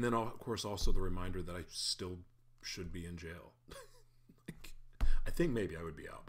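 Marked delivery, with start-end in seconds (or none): none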